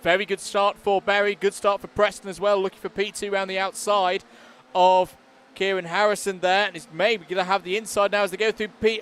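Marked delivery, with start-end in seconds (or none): none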